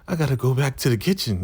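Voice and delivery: high, fast put-on voice; imitated giddy woman. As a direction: rush out an excuse in a high voice. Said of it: "laughing and chuckling".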